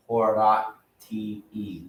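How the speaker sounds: Horati said slowly as hor-AH-tee, every vowel sounded; Latin pronunciation. Shown as a demonstration. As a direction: Horati with a clear E sound at the end. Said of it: "There's no such thing as a silent vowel in Latin".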